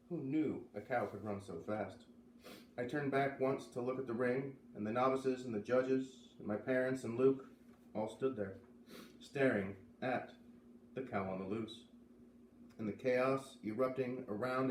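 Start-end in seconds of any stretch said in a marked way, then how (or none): none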